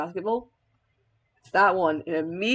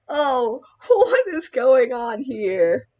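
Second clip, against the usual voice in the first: slow, louder hammed-up bafflement; raised, drawn-out mock dismay; mock bewilderment; 4 semitones higher, 5 dB louder, much slower than usual. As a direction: play the poor baffled sidekick, drawing it out. Acts bewildered John Watson's reaction.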